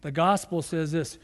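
ordinary talk, nothing marked

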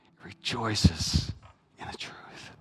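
slow hush dropping to a whisper. Real, not acted. Preacher hushing the congregation on rejoicing in truth. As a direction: breathe the words, barely voiced.

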